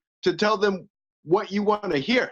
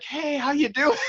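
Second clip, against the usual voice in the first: mock friendly greeting; 8 semitones higher than usual.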